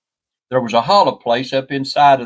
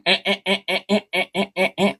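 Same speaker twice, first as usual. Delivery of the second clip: high, soft repeated crow squawks; crow alarm call; 7 semitones higher, 5 dB quieter, faster than usual. Retold or imitated. Crow fussing on the tree trunk, imitated squawking. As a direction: imitate a crow's short, even "eh" calls. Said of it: "started going".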